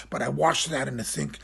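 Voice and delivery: normal talking voice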